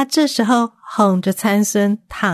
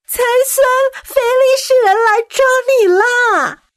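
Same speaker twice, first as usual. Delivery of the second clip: high, loud alarm call; shouting; 4 dB louder than usual. A narrator's reading. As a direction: yell out the warning. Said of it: "就大喊着". (大声惊叫)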